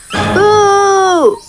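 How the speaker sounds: high, loud "Boo!" jumping out; big hippo's surprise yell; playful scare shout. Acted. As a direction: shout it to startle.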